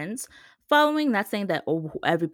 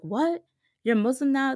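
surprised; 7 semitones higher, 5 dB louder than usual. Retold or imitated. Dad hears the news.

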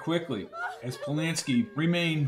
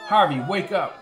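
raised, urgent call to wake him; urgent shout; 4 semitones higher, 4 dB louder than usual. A narrator's reading. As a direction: rouse a friend who is slipping away. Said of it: "shouted Polanski, shaking his head".

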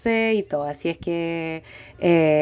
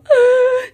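sobbing gasp; 5 dB louder than usual. Retold or imitated. Sobbing on the officer's jacket.

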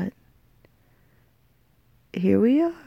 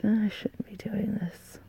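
whispering; 10 dB quieter than usual. Real, not acted. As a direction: whisper it to yourself. Drunk aside to herself.

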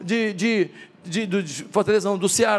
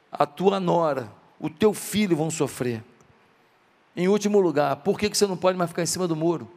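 ordinary delivery

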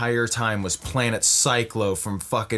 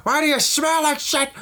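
angry general yelling; 13 semitones higher than usual. Acted. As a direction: shriek at the kid in outrage. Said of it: "and he's like".

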